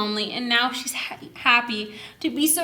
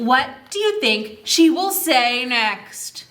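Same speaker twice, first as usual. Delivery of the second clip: ordinary delivery, nothing marked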